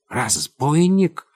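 high, slow, drawn-out outraged question; indignant exclamation. A narrator's reading. General called a robber by Olga, indignant exclamation. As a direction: echo the insult in disbelief. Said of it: "вскричал Берон".

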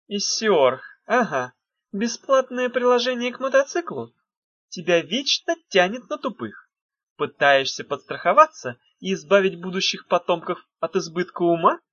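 sarcastic mocking jab; snide. A narrator's reading. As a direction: needle her sarcastically. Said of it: "съехидничала Ната".

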